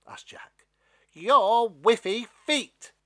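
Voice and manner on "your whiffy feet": mocking. Stalk mocks Jack's feet.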